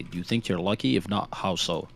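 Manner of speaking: usual speaking voice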